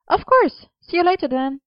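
very high, chirpy colleague signing off; high-voiced, breezy agreement; Gwen's voice. A narrator's reading. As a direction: pitch right up for an easy goodbye. Narrator voices Gwen agreeing.

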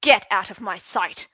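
loud, angry dismissal; furious order. Acted. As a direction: bark him out. Enraged headmaster dismisses him.

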